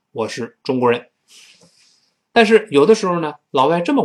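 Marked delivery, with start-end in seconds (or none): none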